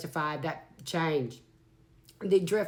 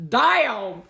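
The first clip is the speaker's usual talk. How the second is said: loud, high burst of surprise; loud exclaim; 5 semitones higher, 8 dB louder than usual. Real, not acted. Reader shocked by the card, loud exclaim. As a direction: blurt it out loud. Reacting to the cards.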